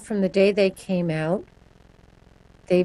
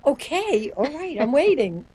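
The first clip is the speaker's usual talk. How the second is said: placating; 5 semitones higher than usual. Retold or imitated. Pedestrian answering the angry crossing signal.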